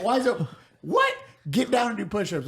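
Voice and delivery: hammed-up confused general; mock baffled general. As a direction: act the general sputtering in disbelief. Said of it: "He'd be like".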